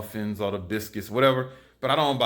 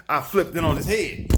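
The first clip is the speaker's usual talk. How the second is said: triumphant catchphrase, said a touch louder; emphatic catchphrase; 4 semitones higher, 3 dB louder than usual. Acted. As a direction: punch it out, a bit louder and proud. Catchphrase punchline, then repeated.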